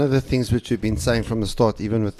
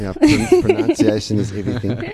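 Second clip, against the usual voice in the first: laughing; 5 dB louder than usual. Real, not acted. Joking tease after correction.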